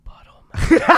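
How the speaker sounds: hushed unvoiced whisper. Small boy saying goodbye, whispered. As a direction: breathe it like a secret.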